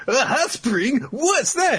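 very high, eager yelling; excited high shout. Retold or imitated. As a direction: blurt it like an eager kid. Asta excited, voiced.

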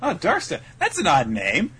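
high, mock-curious repeating of names; lightly acted bemusement, starting high; puzzled girl. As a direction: imitate a girl puzzling over a name. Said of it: "And she's like".

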